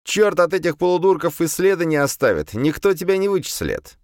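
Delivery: high-pitched coaxing demon voice; the demon tempts him to let it happen; demon persuading. A narrator's reading. (высокий голос демона, уговаривает)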